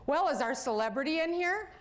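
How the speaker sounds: high, bright greeting quote; jovial doctor. Retold or imitated. Doctor greeting the girl, cheery high-pitched welcome. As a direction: playfully announce the celebrity.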